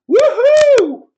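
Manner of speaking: very high, very loud triumphant whoop; wild cheer. Retold or imitated. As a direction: whoop like you just crossed fire. Father-in-law cheering after coals.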